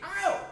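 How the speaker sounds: high, soft ow; squeaky acted ouch; mock pain yelp. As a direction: give a little pretend yelp of pain. Acting out the strained oblique.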